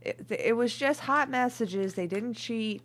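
high, loud indignant protest. Acted. Reader loudly insisting it was only messages. Repeats protest louder then calmer.

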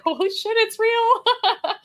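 high, laughing awe; teary laugh of wonder; laughing disbelief. Retold or imitated. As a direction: gasp it out through a laugh. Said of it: "I was like".

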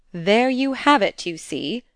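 high, loud, slow opening, dropping away; pregnant remark. A narrator's reading. Governess pointing it out to her pupil, pregnantly. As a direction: make it heavy with meaning. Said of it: "she pregnantly remarked to her pupil".

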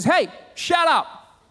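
high, slow, punched-out mock yell; acted annoyed command, drawn out and high; ticked-off shout. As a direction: shout down a storm, irritated. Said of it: "he's reasonably ticked".

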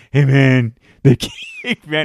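loud bro voice breaking into a laugh; mocking dude voice. Smug guy repeating man at a stranger, laughing. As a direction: keep needling, man. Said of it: "he keeps saying man".

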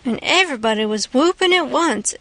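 plain reading voice

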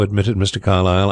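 plain reading voice

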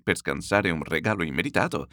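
ordinary delivery

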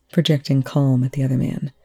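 ordinary delivery

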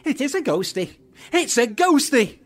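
high, loud, rising panic; frightened. Acted. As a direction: wail in terror at a ghost. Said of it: "I'm frightened".

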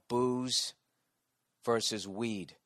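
ordinary talk, nothing marked

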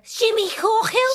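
high, loud, drawn-out Scouse cry; Brookside soap impression. Guest re-enacting Brookside scene, hammed-up high shout. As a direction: yell the name like soap melodrama. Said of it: "remember Brookside?".

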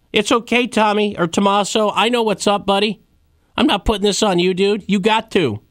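high-pitched Brooklyn buddy talk; Brooklyn accent. Acted. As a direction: reassure a pal in a Brooklyn accent. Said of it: "I'm doing a Brooklyn accent".

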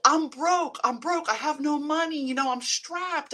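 high, loud whining about money; whiny broke complaint. Retold or imitated. Mimicking people who complain about money, high whine. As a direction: moan like someone broke.

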